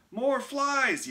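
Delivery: high, loud angry yell. A narrator's reading. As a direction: yell at the pests. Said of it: "yelled Mike".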